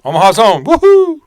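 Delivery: loud, high whooping celebration; excited cheer. Real, not acted. Host celebrating the Omaha home run, loud whooping cheer. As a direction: cheer like your team just tied it.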